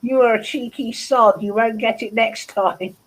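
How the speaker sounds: ordinary talk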